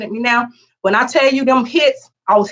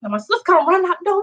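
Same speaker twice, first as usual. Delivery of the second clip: ordinary delivery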